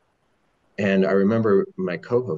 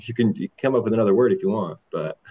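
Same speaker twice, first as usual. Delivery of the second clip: laughing through fast, louder words; 4 semitones higher, 4 dB louder, faster than usual. Real, not acted. Telling listeners they can pick another word, laughing. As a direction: joke it off while still laughing. Laughing aside after therapist quote.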